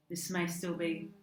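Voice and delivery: ordinary delivery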